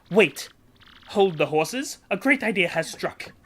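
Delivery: hammed-up Italian-style merchant, grand and theatrical; hammy Flavio accent. Voicing Flavio introducing himself to Mario, hammy Italian-style accent. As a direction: ham up a boastful rich trader with a put-on Italian lilt. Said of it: "need a voice".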